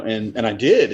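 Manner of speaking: normal talking voice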